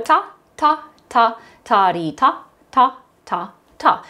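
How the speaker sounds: steady, spaced syllable chant; rhythm syllables. Shown as a demonstration. Teacher leads class through the first line, chanting. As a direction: keep each syllable on the beat. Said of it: "speak this on rhythm syllables".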